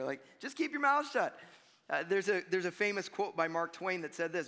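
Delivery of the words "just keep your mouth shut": higher-pitched imitation of a nagging mom; raised-pitch motherly telling-off; scolding mother. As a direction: scold like a mother who has had enough. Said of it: "It sounds like a mother".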